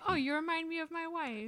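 fast, high tipsy voice. Retold or imitated. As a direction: play a happy drunk. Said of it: "he's super drunk and he goes".

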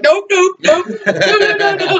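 high, laughing panicked nopes; mock-panic backing away from the bees; panicked refusal. Recalling bees attacking on the line, high panicked nopes.

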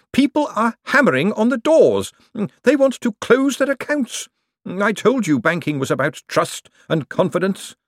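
high, anxious banker voice; alarmed, reproachful clerk; agitated clerk. A narrator's reading. Bent reports the panic.

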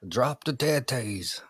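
put-on Irish accent. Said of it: "that's a horrible impression of my, uh, Irish accent".

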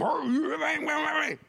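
high, sing-song mock of the know-it-all; lightly mocking sing-song imitation; mocking the advice giver. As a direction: parrot the silly advice, mocking. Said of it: "And you, you show 'em".

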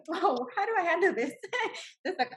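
high-pitched, laughing disbelief; amused bewilderment. Retold or imitated. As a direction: puzzle aloud, pitch climbing into laughter. Bewildered reaction retold with laughter.

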